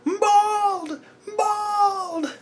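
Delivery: very high falsetto "Bald!", laughing; squeaky high laughing shout. Pretend. Mocking the bald hero.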